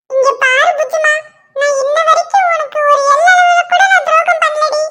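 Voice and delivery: a hint of a wheedling husband; pleading husband. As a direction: sweet-talk your wife out of her anger. Husband pleading with angry wife.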